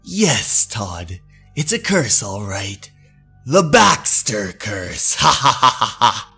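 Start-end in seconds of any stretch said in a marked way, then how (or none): 3.5-6.3 evil laugh. Acted. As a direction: gloat over the curse. Villain laughs at his victim.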